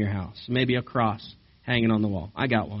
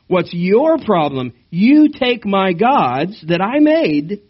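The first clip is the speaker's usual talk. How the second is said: louder, slow, furious retort; indignant outburst; 6 semitones higher, 7 dB louder, slower than usual. Acted. Micah yelling at the men of Dan who took his gods, angry. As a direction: throw the question back in his face. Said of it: "No, not "What's my problem?".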